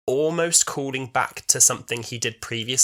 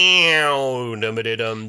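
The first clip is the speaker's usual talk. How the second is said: cliffhanger sting imitation; much slower than usual. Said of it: "a real proper ... cliffhanger".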